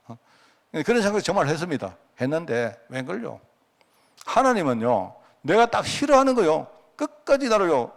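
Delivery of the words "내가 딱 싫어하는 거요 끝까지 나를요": pitched up high, building emphasis; rising sermon emphasis. Real, not acted. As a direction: preach it higher with insistence. (높은 음으로 힘주어 강조하며)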